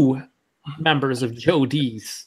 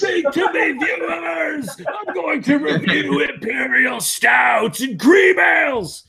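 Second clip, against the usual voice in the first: high shouted wrestler promo; Ultimate Warrior promo; 11 semitones higher, slower than usual. Pretend. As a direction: roar it like a pumped-up wrestler. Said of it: "only if I can cut a promo like him".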